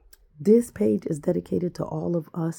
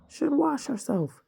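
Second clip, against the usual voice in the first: mimicking; 12 semitones higher, slower than usual. Mimicking the tutorial woman.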